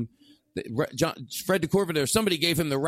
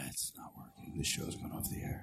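barely voiced, very soft aside; mock hushed panic; 17 dB quieter than usual. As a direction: whisper it as if the show is dying. Hushed aside imagining show failing.

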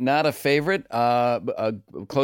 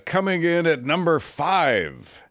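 Shadow Stevens; 5 semitones higher than usual. Acted. Guest counts down his top five songs, announcer impression. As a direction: stretch it like a radio chart host. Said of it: "my Shadow Stevens voice".

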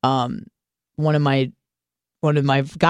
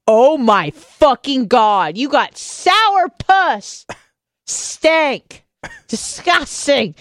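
high, slow, dragged-out disgust; disgusted drawn-out exclamation; 9 semitones higher, much slower than usual. Retold or imitated. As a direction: stretch every word in revulsion. Retold disgusted reaction.

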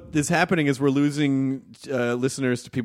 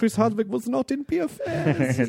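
high-pitched mock German voice; comic German accent pitched well up; 10 semitones higher than usual. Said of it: "That's a good German accent".

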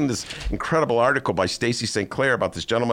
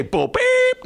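"Bull beep" much higher and louder; incredulous bull beep; 5 dB louder than usual. Shown as a demonstration. Asking the producer if he can't say it, high and loud. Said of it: "You mean I can't say".

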